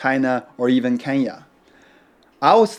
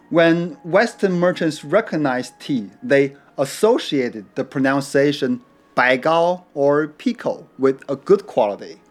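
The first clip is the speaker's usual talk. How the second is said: ordinary delivery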